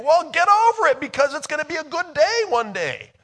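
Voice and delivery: loud, high, brushing-off voice of someone who doesn't care; flippant dismissal. Pretend. Pastor voices an uncaring Jesus to the congregation, flippant and loud. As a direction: toss it off carelessly, loud and high. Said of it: "he doesn't look at it flippantly saying".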